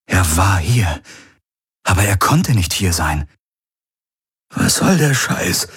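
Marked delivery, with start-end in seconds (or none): 4.5-5.7 higher, strained croak. A narrator's reading. Garland protests at the apparition before him, croaked. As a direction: croak it out in disbelief. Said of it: "krächzte Garland".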